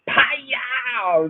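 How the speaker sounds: high, stretched-out "pow"; mock-impact yell; imitated slap. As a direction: draw the smack out long and high. Imitates grandmother's slap again.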